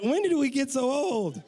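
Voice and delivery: high, hammed-up wail; comic lament. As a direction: wail it in mock despair at ageing. Comic wail about ageing with wife.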